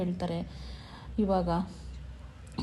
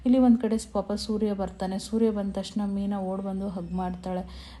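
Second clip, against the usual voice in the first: ordinary delivery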